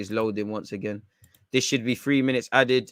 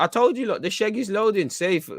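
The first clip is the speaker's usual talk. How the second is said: excited prediction; 9 semitones higher than usual. Real, not acted. Goal coming, fan shouts prediction.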